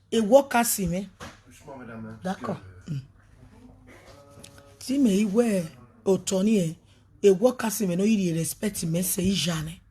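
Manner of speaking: ordinary delivery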